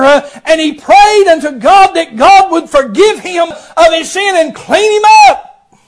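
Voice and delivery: high, loud preacher's call building to a shout; full-force preaching. Acted. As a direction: rouse the congregation with amens.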